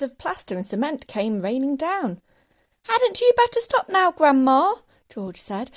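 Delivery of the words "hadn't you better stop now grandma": high, loud pleading question; raised, higher voice of an alarmed child; worried boy. A narrator's reading. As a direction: urge her anxiously to stop. Boy warns growing grandma.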